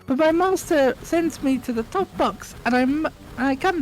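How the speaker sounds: high timid elf squeak; small eager elf introducing herself; house elf voice. Acted. Winky greets Harry, high timid squeak.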